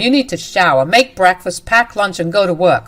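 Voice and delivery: loud, contemptuous husband shouting orders; angry yelling. Acted. Husband berating his wife to get up for work, loud angry yell.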